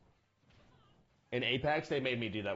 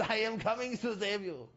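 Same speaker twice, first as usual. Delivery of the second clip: medic impression; 11 semitones higher than usual. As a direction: ham up the eager battlefield medic. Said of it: "yes, the medic impression".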